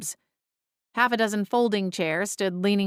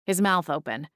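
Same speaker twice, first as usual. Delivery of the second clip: ordinary delivery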